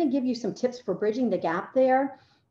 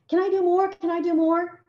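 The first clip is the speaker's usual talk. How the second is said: high, louder, excited student plea; imitating overeager students, pitched up; eager pupils; 8 semitones higher, 5 dB louder than usual. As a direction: beg the teacher eagerly, like keen kids. Voices keen students begging for more.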